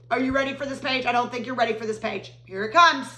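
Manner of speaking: usual speaking voice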